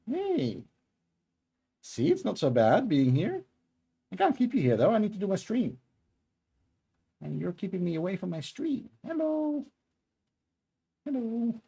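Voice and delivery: high sing-song voice for the cat; cat talk. Real, not acted. Talking to cat on her lap.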